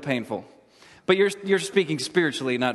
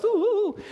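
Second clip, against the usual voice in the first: high mock ghost wail; hammed-up spooky ooh; spooky ghost moan.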